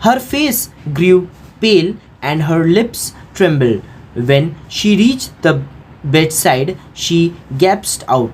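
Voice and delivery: very slow, careful read-aloud of English; slow textbook reading. A narrator's reading. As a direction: read each word slowly for the class. Teacher reads English text aloud.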